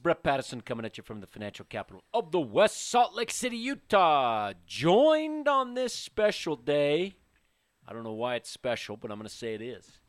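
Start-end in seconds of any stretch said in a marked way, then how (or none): 0.0-7.2 hype intro. Acted. Podcast host hype intro.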